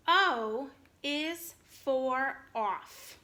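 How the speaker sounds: slow, clear reading with a pause after each word; slow model reading. Shown as a demonstration. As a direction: read it slowly for the class to copy. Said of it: "I'm gonna read it to you".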